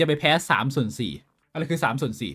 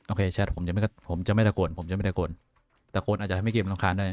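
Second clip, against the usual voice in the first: softer, deliberately restrained; holding back voice; 8 semitones lower, 6 dB quieter than usual. Real, not acted. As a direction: keep it down so you don't shout. (พูดเบา ๆ กลั้นไม่ให้ตะโกน)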